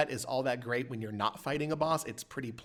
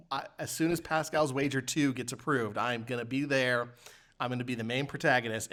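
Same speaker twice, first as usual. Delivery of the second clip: ordinary talk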